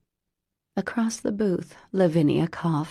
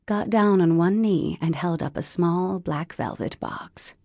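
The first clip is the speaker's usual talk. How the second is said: usual speaking voice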